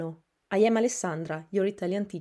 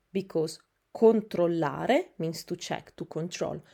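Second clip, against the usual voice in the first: normal talking voice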